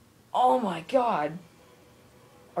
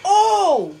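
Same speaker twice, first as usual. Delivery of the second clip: high, loud oh; shocked yell; 9 semitones higher, 9 dB louder than usual. Real, not acted. Player reacting to a crushing blow. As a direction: cry out in disbelief. Big hit in game.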